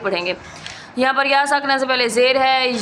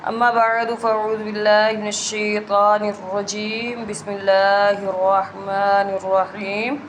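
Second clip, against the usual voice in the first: measured recital in a slightly lowered voice; formal Arabic invocation; 2 semitones lower than usual. Acted. Teacher opens the Quran course with prayers, formal recitation.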